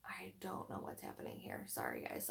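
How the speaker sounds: very quiet, low near-whisper; muttering to self. Real, not acted. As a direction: mumble it under your breath, almost whispering. Lost in chat, muttering to herself.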